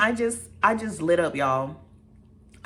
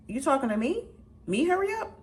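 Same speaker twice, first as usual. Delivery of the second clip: high, incredulous questions; can't believe she said that, way up; offended high double take; indignant disbelief; 8 semitones higher than usual. Retold or imitated. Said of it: "I was like".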